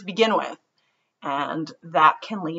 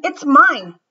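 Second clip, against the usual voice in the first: put-on higher, louder childish insisting; kid's quarrel; 8 semitones higher, 9 dB louder than usual. Martin quarrels with Ruthie over the camera, loud. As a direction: squabble like a stubborn kid.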